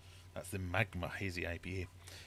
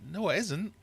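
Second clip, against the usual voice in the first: mock contradiction; 12 semitones higher, 4 dB louder than usual. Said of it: "I'm like".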